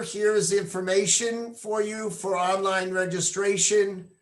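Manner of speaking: ordinary delivery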